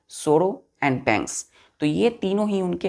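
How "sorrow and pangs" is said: slow recitation. Shown as a demonstration. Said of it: "teacher recalls antonym words slowly".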